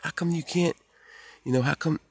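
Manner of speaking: high, whiny mock protest; high-pitched mock grievance; retold argument voice. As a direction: squeak out the complaint like a sulky kid. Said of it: "and I was like".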